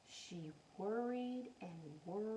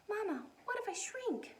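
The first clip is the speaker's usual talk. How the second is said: put-on worried child voice; 9 semitones higher than usual. Wemberly voiced worrying.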